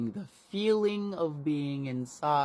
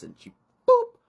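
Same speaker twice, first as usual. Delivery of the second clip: popping boop; 14 dB louder than usual. Retold or imitated. Sound of the baby popping out.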